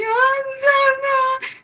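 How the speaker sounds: high, slow whiny mock sob; mocking fake crying. Retelling a woman crying at the vigil, mock sobbing high. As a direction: parody her tearful whine. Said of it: "she started crying and being like".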